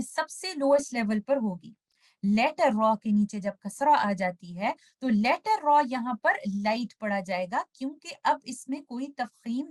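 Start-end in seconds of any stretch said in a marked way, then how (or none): none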